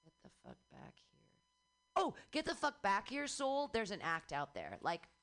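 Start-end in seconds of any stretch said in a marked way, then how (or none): 0.1-1.2 unvoiced, fast whisper of the line; breathy whispered get the fuck back here; whispered callback. Shown as a demonstration. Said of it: "replaying bit". 2.0-3.7 higher call to the departing soul. Shown as a demonstration. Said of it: "suggesting the act".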